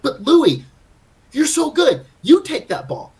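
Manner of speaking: high, loud mock pleading with a teammate; retold emphatic urging. Player urging a too-modest partner to take the ball, emphatic and high. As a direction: push each phrase up high and loud, imitating an exasperated plea.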